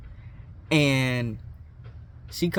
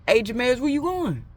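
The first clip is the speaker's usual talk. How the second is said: calling out; 9 semitones higher than usual. Retold or imitated. Calling out to escaping friend.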